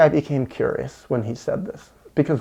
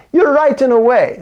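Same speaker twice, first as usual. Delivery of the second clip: quoted Marine; 10 semitones higher, 6 dB louder than usual. Retold or imitated. Quoting the Marine's reply.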